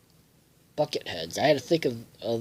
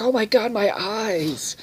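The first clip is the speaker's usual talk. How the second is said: high hammed-up shocked cry; mock horror; 9 semitones higher, slower than usual. Crowd seeing Helga naked, high mock horror. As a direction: recoil in mock disgust. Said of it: "Everybody's like".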